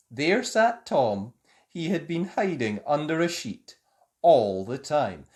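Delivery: plain reading voice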